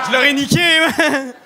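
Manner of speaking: high, loud boast; triumphant shout. Retold or imitated. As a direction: yell it like you won. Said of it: "j'étais comme". (cri vantard, fort et aigu)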